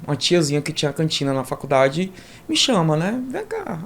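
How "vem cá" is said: high, soft beckoning call; a lightly voiced canteen aunt calling him; mild imitated aunt's call. Aunt at canteen calls him over.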